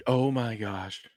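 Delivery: ordinary talk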